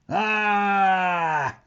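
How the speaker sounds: high, loud storybook roar of fright; a big startled pirate "Ah!"; hammed-up cry of alarm from the crew. A narrator's reading. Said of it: "roared the pirates".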